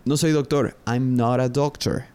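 ordinary delivery

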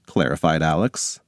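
usual speaking voice

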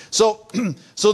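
normal talking voice

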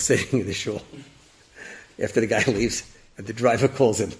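ordinary delivery

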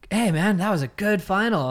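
breezy dad congratulating his son; imitating dad. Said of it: "he's just like".

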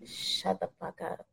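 very soft, slow dismissal; hushed disgust. Retold or imitated. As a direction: say it under the breath, drawn out. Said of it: "it was just like".

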